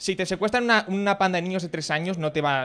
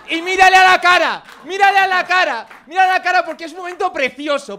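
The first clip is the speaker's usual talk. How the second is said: high, loud, emphatic urging; gleeful insistence; 10 semitones higher, 9 dB louder than usual. Real, not acted. Coaching audience to stare down the snob, loud relish. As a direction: hammer the repeated instruction with relish.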